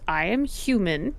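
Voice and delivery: ordinary delivery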